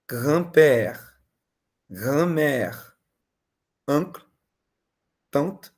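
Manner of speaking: each French word said slowly and clearly, pausing for students; repeat-after-me drill. Shown as a demonstration. Teacher leads the class through the family words, paced for repeating. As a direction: drill the word list for echoing. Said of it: "eu vou repetir uma vez, vocês vão repetindo comigo".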